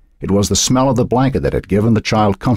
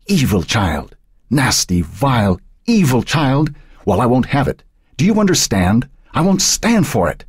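building from hissed insult to a much higher furious peak; raging rant; 8 semitones higher than usual. A narrator's reading. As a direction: let the rage climb to a scream. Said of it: "the raging voice ranted on".

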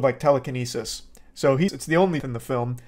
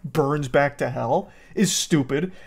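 normal talking voice

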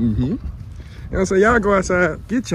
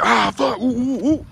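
mock complaining groan; 9 semitones higher, slower than usual. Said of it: "we coulda simply be like".

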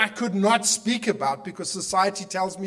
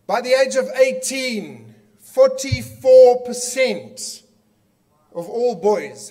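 emphatic, slowed; 6 semitones higher, 9 dB louder, slower than usual. Real, not acted. Shocking statistic announced to audience.

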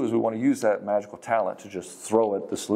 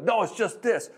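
mock-impatient, much higher-pitched retort; dismissive interruption; 12 semitones higher than usual. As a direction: cut in with the obvious answer. Acts man cutting her off.

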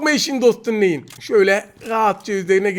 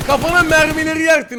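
high, loud taunting yell; shouting at enemy; 7 semitones higher, 4 dB louder than usual. Real, not acted. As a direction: bark it at the opponent. Yelling at enemy in game. (rakibe yüksek sesle bağırış)

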